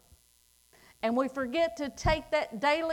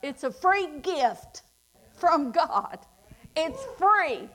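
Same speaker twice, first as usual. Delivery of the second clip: higher, louder preaching climax; 3 semitones higher, 4 dB louder than usual. Acted. Preacher tells the congregation salvation is free, louder and higher. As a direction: drive it home with force.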